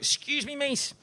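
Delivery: lightly voiced stranger asking for help; high timid plea. Voicing the homeless man.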